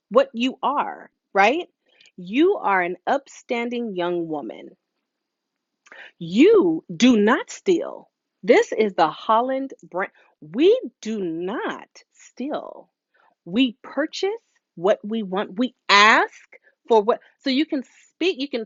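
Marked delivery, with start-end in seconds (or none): 6.2-12.9 very slow, deliberate, each word weighted; speaking life over a child, slow and firm; emphatic declaration. Shown as a demonstration. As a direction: proclaim it slowly like a creed. Preaching the family value.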